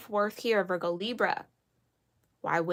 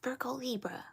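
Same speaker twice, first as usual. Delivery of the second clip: whisper; 5 semitones higher, 8 dB quieter than usual. Real, not acted. Hushed after near-shout.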